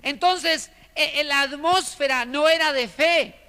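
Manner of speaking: usual speaking voice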